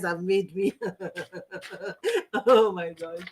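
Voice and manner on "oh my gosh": ordinary talk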